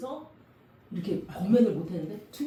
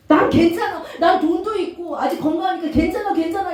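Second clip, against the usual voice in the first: louder, higher breezy self-assurance; smug complacency; 6 semitones higher, 6 dB louder than usual. Retold or imitated. Preacher mocking a complacent believer, smug and loud. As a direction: toss it off like nothing can touch you.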